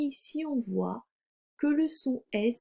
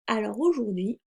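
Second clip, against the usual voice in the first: ordinary talk, nothing marked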